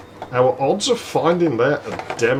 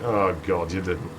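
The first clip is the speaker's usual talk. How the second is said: low, quiet groan of dread; muted dismay; 5 semitones lower, 8 dB quieter than usual. Retold or imitated. Man to laughing mates who upset a girl, muted dismay.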